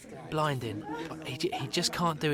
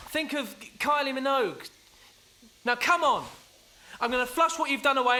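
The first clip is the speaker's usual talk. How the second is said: frantic pep talk; 8 semitones higher than usual. Acted. Rallying groom to give speech.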